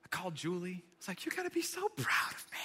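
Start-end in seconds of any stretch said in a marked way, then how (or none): 1.3-2.6 high, intense whisper; whispered boast. Acted. Traveler calling Julie after keeping calm, whispering proudly. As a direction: squeeze out a thrilled, strained whisper. Comic phone call after restraint.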